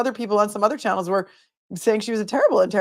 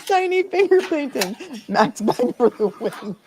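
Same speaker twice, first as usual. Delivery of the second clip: laughing; 4 semitones higher than usual. Real, not acted. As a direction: giggle through it. Laughing at the suspect's words.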